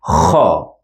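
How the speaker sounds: kha rasped from the back of the throat; like a snore, but from the throat; throat kha sound. Shown as a demonstration. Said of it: "throat sound".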